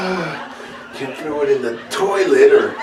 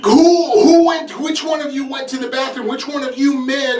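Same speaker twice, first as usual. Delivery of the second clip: high demanding mom voice; mom's stern question; 11 semitones higher than usual. Retold or imitated. Mother demanding who used the bathroom, high and insistent. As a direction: ask like a mother who caught someone.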